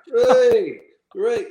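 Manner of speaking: high, loud jeering whoop repeated; mock cheer. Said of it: "all go".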